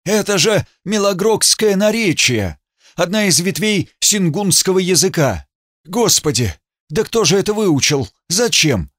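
high, excited scholar; excited exclamation. A narrator's reading. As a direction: cry it out in amazed excitement. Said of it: "вскрикнул".

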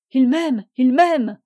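cri déchirant. A narrator's reading. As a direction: tear the words out in despair. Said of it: "cria Fanny d'une voix déchirante". (crier d'une voix déchirante)

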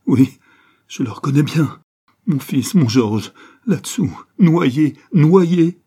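high, loud, slowing wail of grief; sobbing outcry. A narrator's reading. Father realising his son has drowned, sobbing outcry. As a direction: wail it out, building to a cry. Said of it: "sanglotait-il".

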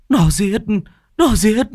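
high, loud panicked cry. Acted. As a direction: shriek it out in terror. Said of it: "Bà Lành hoảng sợ tột độ". (bà cụ hét lên hoảng loạn)

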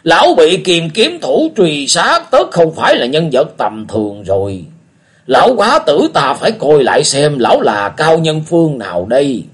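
put-on an old man sizing up a stranger; musing old beggar. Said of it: "tằng hắn giọng một tiếng rồi nói".

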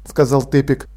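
plain reading voice

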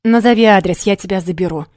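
loud, curt order; commanding. A narrator's reading. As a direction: bark the order down the phone. Fiancé demanding on the phone.

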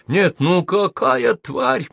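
slow, high moaning lament. A narrator's reading. Host moans into his hands, miserable. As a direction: groan it out in misery. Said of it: "продолжал стонать Арчи".

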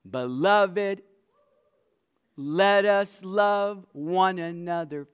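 loud, ringing scripture proclamation; preaching at full force. Acted. Preacher reads First John to the congregation, loud and ringing. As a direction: proclaim it to the back row.

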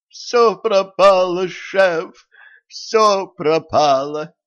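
high, loud, slow panicked cry; despairing wail. A narrator's reading. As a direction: wail that all is lost, drawn out. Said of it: "ломая от отчаяния руки".

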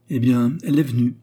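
lightly voiced gossiping widow; old concierge. A narrator's reading. Concierge gives Léon the news, lightly voiced old widow.

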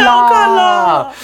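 high, slow excited shout; yelling across the room. Pretend. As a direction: echo the yell playfully. Echoes the shouted line.

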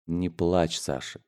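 soft, low, gentle hush; calm the child quietly, voice dropping away; tender low murmur; soothing. A narrator's reading. Father soothes crying son.

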